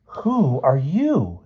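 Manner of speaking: very slow, high, drawn-out rude question. A narrator's reading. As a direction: stretch each word out insolently. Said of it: "said Jane rudely".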